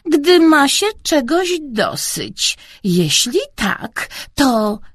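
slow, tight-lipped grandma; measured words forced out slowly; said through clenched teeth. A narrator's reading. Grandma explaining a saying, slowly through clenched teeth. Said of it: "wycedziła babcia Marysia".

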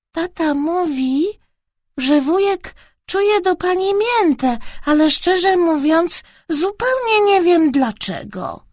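put-on high-pitched child voice; innocent small boy. A narrator's reading. Boy explaining to uncle's friend, innocent child voice. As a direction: speak up high, earnestly puzzled child. Child speaking frankly to a lady.

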